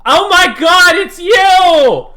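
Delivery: very high, loud excited shriek; obnoxious high-pitched squeal of recognition; shrill screaming woman. Acted. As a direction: scream it like an overjoyed fan. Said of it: "you hear a woman's voice go".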